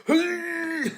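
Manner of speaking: loud, high mock-Japanese samurai shout; drawn-out caricature Japanese cry. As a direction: bellow like a stock Japanese samurai character. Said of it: "my racist Japanese voice sounds like".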